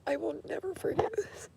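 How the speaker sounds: tearful whisper, voice high; crying through the words; snotty crying. Real, not acted. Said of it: "I'm sorry for ugly crying".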